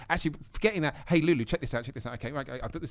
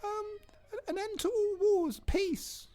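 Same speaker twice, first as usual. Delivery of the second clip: put-on very high little kid voice; squeaky child asking Santa; slower than usual. Adult playing child asks Santa.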